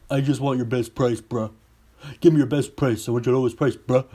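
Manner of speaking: lightly mocking impression of a dim customer; idiotic customer. Customer demands the salesperson's best price, lightly mocked as idiotic. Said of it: "customer says something idiotic like".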